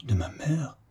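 hushed, pained question, almost whispered. A narrator's reading. Daughter hearing her mother had an enemy, pained. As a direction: breathe it out in hurt disbelief. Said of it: "s'écria douloureusement mademoiselle de Beaumesnil".

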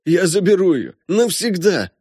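high, impassioned father's vow; desperate colonel imploring; pleading. A narrator's reading. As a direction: beg with pitch climbing. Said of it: "молит Фогдахи".

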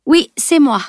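pert, emphatic comeback; retort. A narrator's reading. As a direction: answer back with bravado. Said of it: "rétorqua-t-elle".

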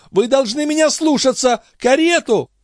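very high, insistent order; frantic command. Acted. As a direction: order the maid about in high-pitched agitation. Distraught mistress orders her maid. (исступлённый приказ очень высоким голосом)

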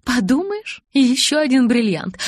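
high, loud scoff; dismissive. A narrator's reading. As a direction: brush it off airily. Alice dismisses Edward's explanation.

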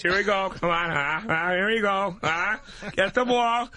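Ray Romano impression. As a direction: whine it like a sitcom dad in bed. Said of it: "Ray Romano having sex".

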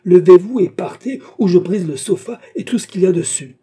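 very loud, high shouted order; high-pitched shouting rage; furious threat. A narrator's reading. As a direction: explode into a threatening command.

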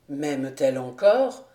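the captain musing alone; to himself. Acted. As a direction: wonder it aloud, low. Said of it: "se dit-il".

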